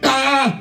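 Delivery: sudden high, loud yelp; weird sex noise. Retold or imitated. Imitating a partner's noise during sex, weird yelp. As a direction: blurt a startling comic cry. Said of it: "a weird noise in a weird time".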